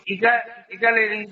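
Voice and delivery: ordinary talk, nothing marked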